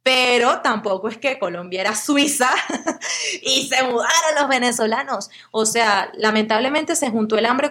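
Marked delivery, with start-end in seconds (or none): 2.5-3.4 bright laugh; a quick amused laugh; short high laugh. Real, not acted. Laugh after Suiza joke. (risa breve y aguda)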